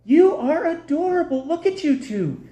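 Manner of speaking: put-on high gushing woman's voice, cooing over a baby; gushing lady. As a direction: coo it up high like a doting stranger. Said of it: "I hear this lady go".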